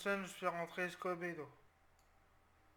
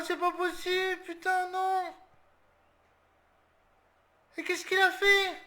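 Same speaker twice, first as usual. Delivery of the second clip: much higher, much louder, drawn out; despairing screams; 10 semitones higher, 10 dB louder than usual. Real, not acted. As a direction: wail in disbelief at the blunder. But encaissé, désespoir. (hurle de désespoir, beaucoup plus aigu et fort)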